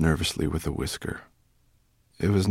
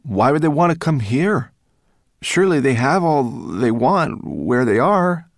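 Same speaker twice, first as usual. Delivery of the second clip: loud, high, puzzled questioning; calling out; 9 semitones higher, 11 dB louder than usual. A narrator's reading. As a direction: call out a doubtful protest. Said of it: "one of the dragons called out".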